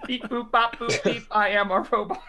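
high, loud toy robot. Retold or imitated. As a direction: beep out a child's robot voice. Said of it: "She comes in".

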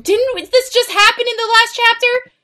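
high-pitched disbelieving question; incredulous outburst. Acted. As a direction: squeak it in exasperated disbelief. Reader baffled by the repeated plot.

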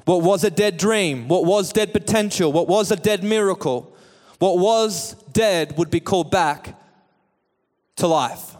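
high, driving full-force sermon voice; impassioned preaching. Real, not acted. Preacher calls dead hopes to come out, impassioned preaching. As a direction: pile up the phrases and call out the commands.

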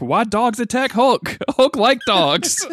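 high and slow, laughing as dumb Hulk; drawn-out giggling Hulk speech. Retold or imitated. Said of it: "He's like".

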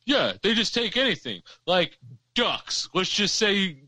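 mock the know-it-all coworker; smartass coworker. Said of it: "and be like".

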